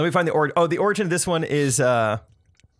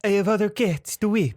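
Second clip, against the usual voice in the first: higher-pitched mock French accent; 6 semitones higher than usual. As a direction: attempt a comic Frenchman's English. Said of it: "say it like a Frenchman".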